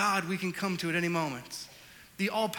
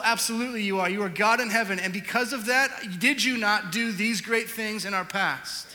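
full-force preaching; 3 semitones higher, 5 dB louder than usual. Acted. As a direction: preach it like a rallying cry.

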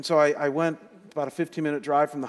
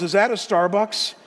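higher and louder, imitating the dying man's sudden question; mock astonished question; 7 semitones higher, 6 dB louder than usual. Retelling his friend's sudden coffee request, amused and surprised. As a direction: echo the ask with delight.

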